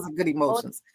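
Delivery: ordinary delivery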